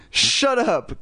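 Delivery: short irritated snap; snapped. A narrator's reading. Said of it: "Chris snapped to the dummy".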